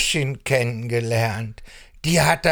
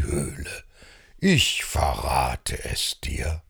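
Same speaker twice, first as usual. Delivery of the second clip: wise calm elder; 5 semitones lower than usual. Acted. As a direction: lower your voice and draw them in. Wise low character voice.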